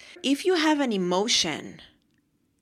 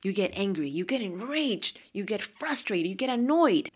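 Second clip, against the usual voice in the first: ordinary talk, nothing marked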